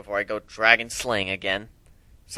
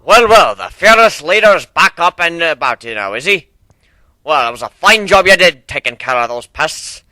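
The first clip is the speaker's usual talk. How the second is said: gruff captain voice; 3 semitones higher, 10 dB louder than usual. Acted. Steelhorn welcoming the fearless leader back, loud. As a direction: greet him like a bold old captain.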